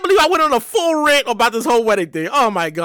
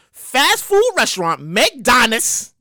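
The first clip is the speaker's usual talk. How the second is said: slow rereading; 5 semitones lower, much slower than usual. Shown as a demonstration. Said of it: "I'm going to read that shit again".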